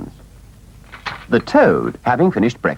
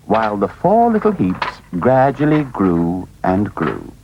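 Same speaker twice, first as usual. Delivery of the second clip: ordinary delivery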